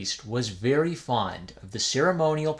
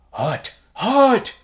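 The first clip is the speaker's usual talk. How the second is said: much higher, loud yelp of pain; the badger kettle yelping from the fire; kettle crying out; 10 semitones higher, 9 dB louder than usual. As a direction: yelp as if scalded. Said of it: "cried the kettle".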